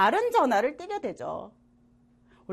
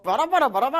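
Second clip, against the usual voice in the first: loud, rhythmic vehicle mimicry; comic delivery-scooter chant; imitated scooter noise; 2 semitones higher, 5 dB louder than usual. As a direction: honk out the syllables, showing off. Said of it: "하면서 막 돌아주고".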